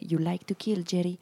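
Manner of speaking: put-on light Scottish accent, quoting the demon cat; mild Scottish accent, goading. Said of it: "Il y a un accent écossais".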